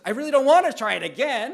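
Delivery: loud, fast mock exasperation; exasperated wife. Preacher imitating his wife refusing to repeat herself, loud and fast. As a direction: blurt it out, fed up. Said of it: "she's like".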